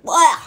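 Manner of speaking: loud, high blah of disgust. A narrator's reading. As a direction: reject the taste with a loud blah. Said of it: "said Brother".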